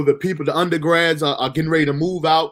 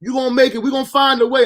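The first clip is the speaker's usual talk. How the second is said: higher voiced big cousin cheering him on; cousin's encouragement; 8 semitones higher than usual. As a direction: imitate the cousin reassuring him, pitched up. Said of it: "He's like".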